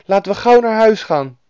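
put-on high, loud eager child; little boy. A narrator's reading. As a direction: urge a friend to hurry as a young boy. Freed boy urges going home.

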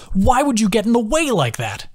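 high, exasperated near-shout; half-yelling. A narrator's reading. Carson scolds Adam for taking the hit, half-yelling. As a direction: scold him, almost yelling. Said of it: "somewhere between talking and yelling".

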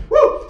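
very high, loud whoop; preacher's whoop. Real, not acted. Preacher whooping at the congregation, very high and loud. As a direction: let out a shout of joy.

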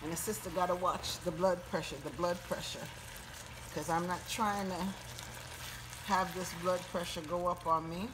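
normal talking voice

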